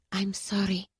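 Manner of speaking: soft unvoiced whisper; whispered apology. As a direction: breathe the apology quietly. Ayla breaks bad news gently.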